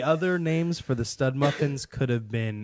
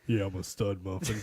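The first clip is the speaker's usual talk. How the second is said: deep firm dad voice; 4 semitones lower, 5 dB quieter than usual. Acted. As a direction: say it like a proud dad. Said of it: "Why do you have to talk like that?".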